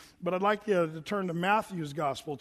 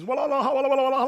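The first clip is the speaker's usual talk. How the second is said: loud laughing walla walla chatter, higher; imitating crowd babble; 7 semitones higher, 8 dB louder, much faster than usual. Pastor imitating the noisy crowds in Jordan, laughing babble. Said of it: "all the people talking".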